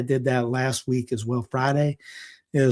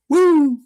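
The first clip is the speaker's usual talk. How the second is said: high, loud wrestler's woo; piercing woo like the Nature Boy; Ric Flair woo; 14 semitones higher, 8 dB louder than usual. Retold or imitated. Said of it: "two claps and Ric Flair".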